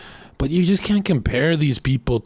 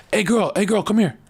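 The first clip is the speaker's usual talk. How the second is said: calling out high, playful; eager pickup line; 8 semitones higher than usual. Retold or imitated. Kneeling guy calling up to a passing girl, playfully. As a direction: hook her attention from down on one knee. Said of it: "I'd be like this".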